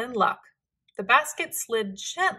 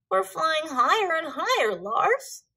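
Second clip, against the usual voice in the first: puffin voice; 6 semitones higher than usual. A narrator's reading. Puffin voice in balloon.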